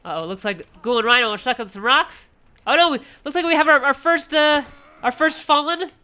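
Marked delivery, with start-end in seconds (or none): 2.7-5.9 high, loud, quick excited call; excited commentator. Real, not acted. Racer falls, commentator reacts.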